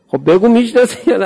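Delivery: high, loud demand; mock interrogation. Strangers at the door interrogating the lover, loud and high. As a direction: press him hard for an answer.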